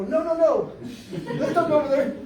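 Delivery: put-on half-laughing, half-scared refusal from the kids; scared laughing girls. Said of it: "They go".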